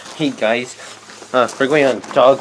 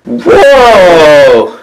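loud startled whoa; surprised shout; 7 semitones higher, 9 dB louder than usual. Real, not acted. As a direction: yelp in sudden surprise. Startled while shaving.

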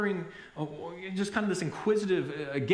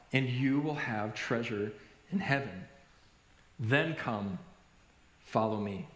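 solemn scripture quote; 6 semitones lower, slower than usual. Acted. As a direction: read it gently and gravely, pausing between phrases. Reads Jesus's words solemnly from scripture.

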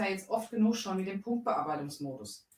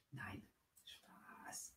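whisper; 20 dB quieter than usual. Real, not acted. Whispered joke retraction.

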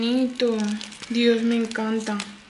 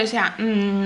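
usual speaking voice

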